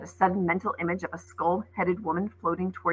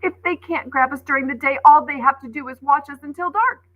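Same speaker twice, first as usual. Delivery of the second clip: frightened warning; 8 semitones higher, 8 dB louder than usual. Acted. Coco warns frightened.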